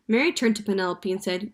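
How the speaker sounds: ordinary delivery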